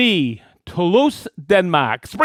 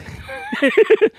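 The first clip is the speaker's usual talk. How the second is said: high laugh. Real, not acted. Laughing at his own joke.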